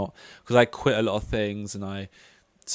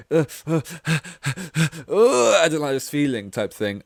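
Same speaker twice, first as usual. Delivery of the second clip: higher, laughing groans of mock disgust; hammed-up shuddering "uh", pitched up; cringing shudder; 6 semitones higher, 5 dB louder than usual. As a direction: act out a full-body cringe in little gasps. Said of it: "my whole body was, like, cringing".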